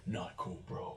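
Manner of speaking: injured Brad mutters at passers-by in a whisper; hushed mutter. Acted. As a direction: breathe it out under your breath. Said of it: "he's like".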